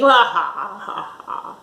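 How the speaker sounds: villain laugh. Acted.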